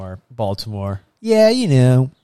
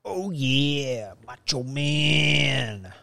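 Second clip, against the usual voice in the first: softer, very slow wrestler drawl; retried Macho Man; 2 semitones higher, 7 dB quieter, much slower than usual. Retold or imitated. Host retries a bad wrestler impression, soft and slow. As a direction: try the wrestler again, quieter. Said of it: "That's not a good Macho Man".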